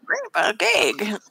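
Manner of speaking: slow, put-on old man voice; Grandpa Gag voice. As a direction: drawl the name like a grandpa. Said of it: "that's not what Grandpa Gag sounds like".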